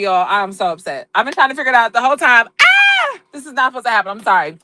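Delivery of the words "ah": very high squeal of frustration; frustrated scream. Real, not acted. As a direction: let out a high, exasperated shriek. Frustrated by the phone glitch.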